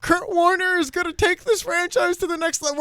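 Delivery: high, mock-tearful voice; hammed-up weepy squeak of false hope; Dick Vermeil crying. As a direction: imitate someone explaining through tears. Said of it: "They feel like Dick Vermeil crying".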